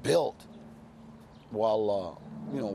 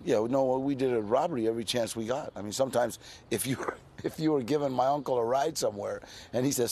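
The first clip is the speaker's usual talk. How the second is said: ordinary talk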